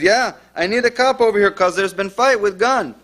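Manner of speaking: put-on high, urgent Spanish-accented English. Second caller reports a gunfight, imitated Spanish accent. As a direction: plead for police in a Spanish accent. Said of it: "And a different guy starts speaking".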